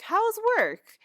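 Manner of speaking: imitating a daughter changing the subject; sudden chirpy dodge. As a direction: switch to a high, loud, innocent question. Acted daughter dodging her question.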